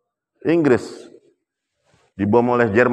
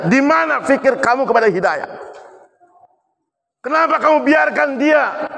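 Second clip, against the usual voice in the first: high, raised, scolding rebuke; angry Umar; 10 semitones higher than usual. Retold or imitated. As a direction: scold him for letting the man go. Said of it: "Langsung Umar marah". (Umar memarahi utusan dengan suara tinggi)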